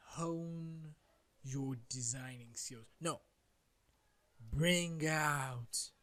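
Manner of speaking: low eerie trailer voice; drawn-out spooky whisper. Pretend. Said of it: "I sound spooky, right?".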